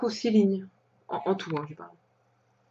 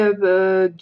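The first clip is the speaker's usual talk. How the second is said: ordinary talk, nothing marked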